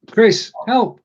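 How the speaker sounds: high-pitched call for help; playful plea. Real, not acted. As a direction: call out for rescue, stuck. Mock plea to co-host when stuck.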